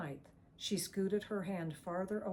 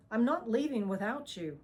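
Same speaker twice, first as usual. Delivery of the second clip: insistent refusal; 5 semitones higher, 6 dB louder than usual. A narrator's reading. Ava refuses to leave.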